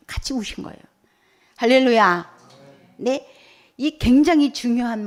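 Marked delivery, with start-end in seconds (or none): 0.1-0.8 soft breathy near-whisper; hushed whisper. Real, not acted. Preacher softens on tender point. 1.6-2.4 loud hallelujah with a falling end; joyful shout. Real, not acted. Preacher calls out to congregation.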